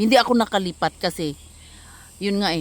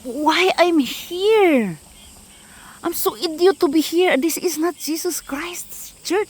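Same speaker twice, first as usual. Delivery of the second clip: despairing self-quote; 8 semitones higher, slower than usual. Retold or imitated. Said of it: "Sabi ko".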